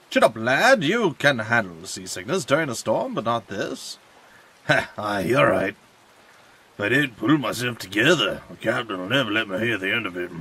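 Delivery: lightly put-on Irish pirate sailor; a hint of a rough Irish sea dog. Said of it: "Perfect pirate voice".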